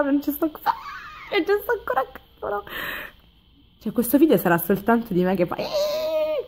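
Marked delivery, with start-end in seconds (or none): 0.0-2.6 high, breaking-up laughter through words; laughing in disbelief. Real, not acted. 5.6-6.4 thin, very high crying-laughing squeal; hysterical squeal. Shown as a demonstration. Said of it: "così come un'isterica".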